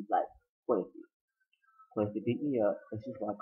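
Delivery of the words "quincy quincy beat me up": weak and quiet. Retold or imitated. Beaten son speaking weakly.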